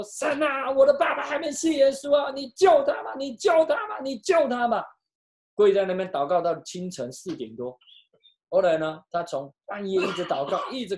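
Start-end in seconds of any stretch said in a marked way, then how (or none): 0.0-4.8 high, loud, sobbing plea to God; wailing prayer. Retold or imitated. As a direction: cry out to God for a father, wailing. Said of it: "她就放声大哭说".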